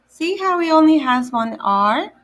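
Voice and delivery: usual speaking voice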